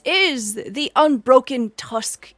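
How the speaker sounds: unhurried, smiling festival host; warm orc hostess. Acted. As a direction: present your inn heartily, leaning on the words. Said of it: "She smiles up at you warmly".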